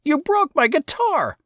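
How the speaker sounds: put-on high, tearful kid yell; Saul, crying out. A narrator's reading. Saul yells through tears at his brother. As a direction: cry it out like a heartbroken boy. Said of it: "tears on his cheeks".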